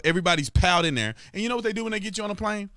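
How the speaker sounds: ordinary talk, nothing marked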